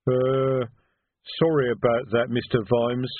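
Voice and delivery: troll voice, thick and sheepish; apologetic troll. Acted. Troll apologizing for the misfired crossbow, sheepish rumble. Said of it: "said the troll".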